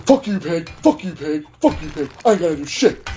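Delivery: imitating an agitated driver, in short bursts; mock agitation. Acting out the driver cursing the officer, agitated. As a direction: spit out repeated mock curses.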